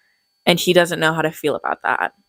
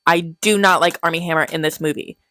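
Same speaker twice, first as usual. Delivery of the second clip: ordinary delivery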